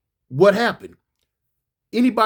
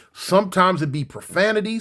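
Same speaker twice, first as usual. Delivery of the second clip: ordinary talk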